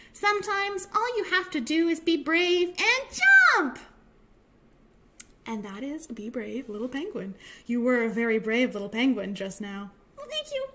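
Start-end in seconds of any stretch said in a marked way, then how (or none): 0.1-3.8 higher, building to a big 'jump'; triumphant declaration. Acted. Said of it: "and said". 10.2-10.7 tiny, very high, soft puppet voice; penguin puppet. Acted.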